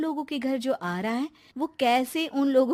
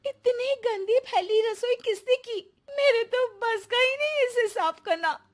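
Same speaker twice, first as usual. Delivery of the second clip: higher, louder cry of dismay at the mess; dismayed shriek at the state of the kitchen; shocked outburst; 9 semitones higher, 4 dB louder than usual. Acted. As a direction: gasp it out, appalled. Sonia discovers filthy kitchen.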